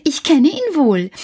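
put-on high little-boy voice; Häwelmann explaining. A narrator's reading. Häwelmann tells the moon about the cat, in a high child's voice. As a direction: tell it like a knowing child. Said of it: "sagte Häwelmann".

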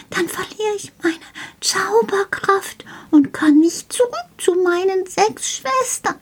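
a tiny gnome close to tears, afraid of never getting home; tearful gnome. Acted. Gnome fears losing his magic and his sisters, high and tearful.

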